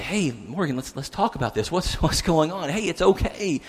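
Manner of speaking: put-on soft, soothing father to an upset child; hushed reassurance; calming. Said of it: "he's trying to calm her down".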